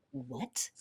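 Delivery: a soft, breathy, high stunned what. Retold or imitated. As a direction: mouth it in quiet disbelief. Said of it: "I was like".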